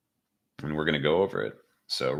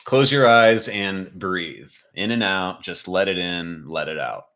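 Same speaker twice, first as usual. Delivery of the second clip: guided meditation. Acted.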